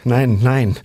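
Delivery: frantic refusal; desperate pleading. A narrator's reading. Husband pleading, words slipping.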